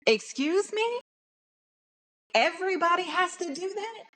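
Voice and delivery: very high, rising disbelief; incredulous. Acted. Answering coworkers' claim that everybody code-switches, incredulous. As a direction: echo it back as an outraged question.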